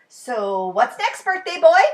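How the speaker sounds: Ted eager for the next game; Ted's voice. A narrator's reading. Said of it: "asked Ted".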